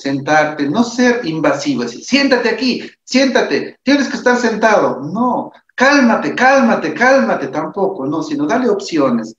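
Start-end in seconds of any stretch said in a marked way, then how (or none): 2.0-5.0 high, insistent bossy orders; pushy helper. Shown as a demonstration. Ejemplo de mal trato, imperativo. 5.7-7.5 high, louder repeated orders; insistent calm down. Shown as a demonstration. Ejemplo de mal trato, ordena calmarse.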